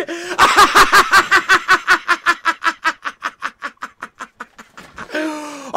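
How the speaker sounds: breathless disbelieving laughter; real delighted laughter; giddy laugh. Real, not acted. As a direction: laugh out the shock of the goal. Laughing after goal.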